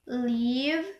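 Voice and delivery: "leave" with a long, stretched E. Shown as a demonstration. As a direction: hold the vowel of "leave" before the V. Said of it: "for the long E is".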